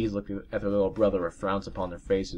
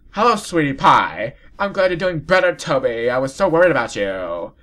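Silver Spoon voice; 5 semitones higher, 10 dB louder than usual. Acted.

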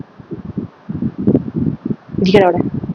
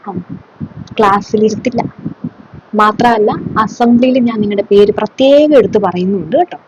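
ordinary talk

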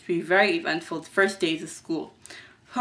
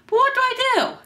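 high-pitched, helpless questioning; bewildered question; 4 dB louder than usual. Acted. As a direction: ask it at a loss, voice way up. Hero asks helplessly for instructions.

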